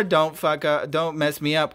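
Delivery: usual speaking voice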